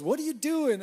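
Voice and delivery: high, loud call up to the scaffolding. Pretend. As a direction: holler up at a friend. Imagined call from Tony.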